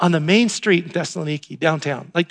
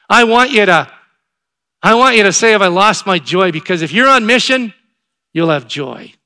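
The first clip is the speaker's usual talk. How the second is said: loud, raised preaching voice; urgent exhortation; 3 semitones higher, 7 dB louder than usual. Real, not acted. Pastor challenging the congregation about lost joy, urgent exhortation. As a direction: press the challenge home forcefully.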